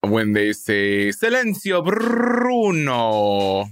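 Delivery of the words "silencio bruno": high-pitched, drawn-out movie quote; mock-dramatic command to an inner voice, pitched up; movie catchphrase. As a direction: cry it out theatrically, stretching the name. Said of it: "It's like that movie, like, when they say".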